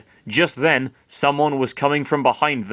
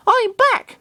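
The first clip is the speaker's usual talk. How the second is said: much higher, squeaky creature voice; high Lucario voice; 4 dB louder than usual. Acted. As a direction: announce his return in a tiny high voice. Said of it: "Lucario said".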